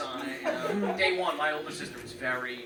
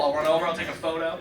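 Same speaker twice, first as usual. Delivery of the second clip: ordinary talk, nothing marked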